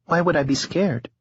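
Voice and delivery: a hint of a tired young woman. A narrator's reading. Harlow voiced in narration.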